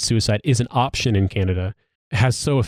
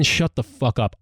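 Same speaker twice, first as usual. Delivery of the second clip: normal talking voice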